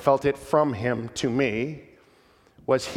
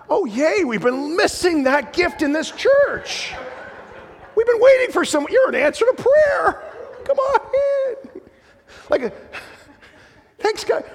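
sarcastic, much higher, laughing through it; mock delight; 4 dB louder than usual. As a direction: gush with fake joy at the critic. Sarcastic welcome of critical man.

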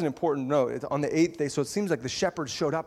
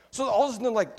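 ordinary talk

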